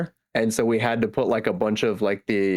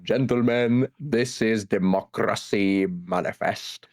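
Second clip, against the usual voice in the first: rolled r's, slow and grand; imitating the democracy manifest man; Australian accent; slower than usual. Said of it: "say the democracy, uh, manifest part".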